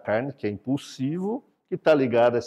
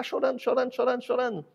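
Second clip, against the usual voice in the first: higher, whiny imitation of a crying baby; mock baby crying; 9 semitones higher, faster than usual. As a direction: act out the baby who won't stop crying. Imitating baby who keeps crying. (imitação chorosa de bebê, voz mais aguda)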